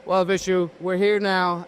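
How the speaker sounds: ordinary talk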